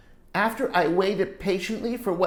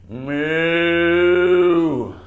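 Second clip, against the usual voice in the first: a big mooing cow call, louder; 2 semitones lower, 7 dB louder than usual. Shown as a demonstration. Teacher leads class in loud cow moo. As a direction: moo like a cow so parents hear. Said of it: "really loudly".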